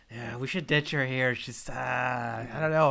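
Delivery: low mock voice. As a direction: drone it out, unimpressed. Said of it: "I was".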